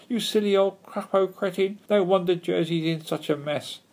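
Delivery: high-pitched jeering; mocking shout. A narrator's reading. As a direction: heckle with gleeful scorn. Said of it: "mocked the Pooks, shouting at the gull".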